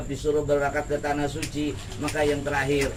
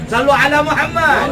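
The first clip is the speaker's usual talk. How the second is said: raised, high call to the congregation; loud call; 9 semitones higher, 10 dB louder than usual. Real, not acted. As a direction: rouse the hall to answer. Preacher calls congregation to respond.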